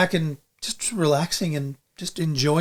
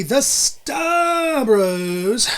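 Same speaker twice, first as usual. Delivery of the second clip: announcer's drawn-out name call; ring announcer; 3 semitones higher, slower than usual. Pretend. Host signing off guests like a ring announcer, drawn-out announcer call. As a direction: stretch the names out, rising on the last.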